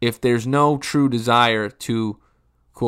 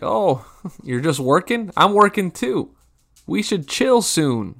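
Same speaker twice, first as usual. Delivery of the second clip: fake enthusiasm; 8 semitones higher, 3 dB louder, slower than usual. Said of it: "And they're like".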